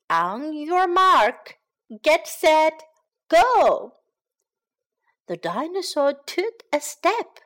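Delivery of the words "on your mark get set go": starter's call, rising to a shout; on your mark, building up; race call building louder. Acted.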